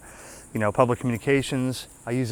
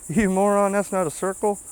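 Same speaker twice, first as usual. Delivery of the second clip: high-pitched imitation of heckling viewers; mock yelling; 9 semitones higher, 4 dB louder than usual. Viewers yelling at him over the lopsided circle, mock yell. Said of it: "they're yelling at me".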